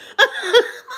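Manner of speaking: high squealing burst of laughter. Real, not acted. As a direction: break into a high shriek of laughter.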